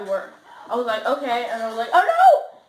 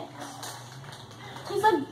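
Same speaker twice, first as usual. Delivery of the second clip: whispering; 4 semitones higher, 10 dB quieter, much slower than usual. Real, not acted. Tasting, savoring whisper.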